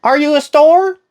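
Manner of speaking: put-on loud, very high old lady; hammed-up elderly caller. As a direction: question it in a put-on old woman falsetto. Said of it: "I'm gonna do my old lady voice".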